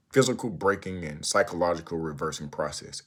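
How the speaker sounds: normal talking voice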